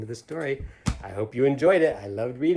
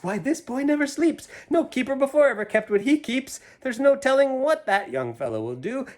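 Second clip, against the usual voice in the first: high, louder amazed townsfolk voice; gasping townsfolk; 10 dB louder than usual. Acted. Townsfolk marveling at the boy, high and amazed. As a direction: gasp it like the awed town. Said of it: "the whole town will gasp".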